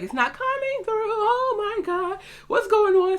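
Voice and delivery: very high-pitched mock wail; dramatized squeal of dismay; retold panic. Retold panic at failed text.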